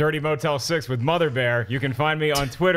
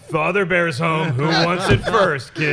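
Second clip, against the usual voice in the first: mock creepy dad; 4 dB louder, slower than usual. Dark bear cartoon parody.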